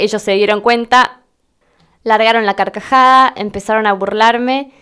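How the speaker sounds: ordinary talk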